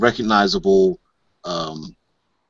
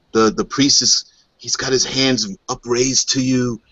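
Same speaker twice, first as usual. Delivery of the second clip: usual speaking voice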